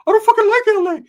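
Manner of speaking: high-pitched screaming character, very loud; Ricky's outraged shriek. Acted. Ricky picks a fight.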